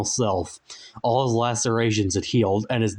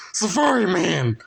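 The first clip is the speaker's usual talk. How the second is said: higher, overjoyed cry of his name; excited cry; 8 semitones higher, 3 dB louder than usual. A narrator's reading. Frank cries out to Safari Man, delighted. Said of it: "cried Frank".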